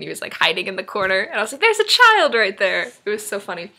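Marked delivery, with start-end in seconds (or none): 1.6-2.9 loud, high, drawn-out mock shriek; stretched-out excited yell; surprised exclamation. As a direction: reenact spotting a child, high and loud. Retelling spotting a hidden child.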